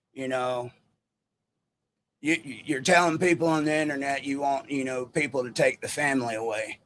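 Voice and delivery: a rough stab at Larry's way of talking; imitating Larry's accent. Retelling Larry's excuse in his accent. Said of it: "my accent isn't that great".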